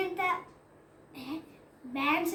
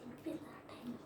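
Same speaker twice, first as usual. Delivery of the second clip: soft whispered aside; whispering; 6 semitones lower, 18 dB quieter than usual. Real, not acted. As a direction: breathe it out, unvoiced.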